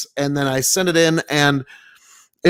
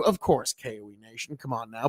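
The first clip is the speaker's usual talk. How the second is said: much softer, lower aside to the audience; quiet aside; 7 semitones lower, 10 dB quieter, faster than usual. Real, not acted. Host aside to viewers amid channel plugs, quiet aside. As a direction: drop right down, as if stating the obvious.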